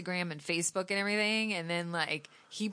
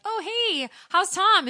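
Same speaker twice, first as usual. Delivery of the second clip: high, bright mock barista greeting; sing-song cafe small talk, pitched up; chirpy barista; 12 semitones higher, 4 dB louder than usual. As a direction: play a cheerful clueless server. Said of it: "baristas is like".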